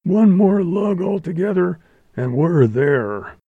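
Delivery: high-pitched rallying call; shouting driver. A narrator's reading. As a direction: urge the team on for a last effort. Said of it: "shouted the driver".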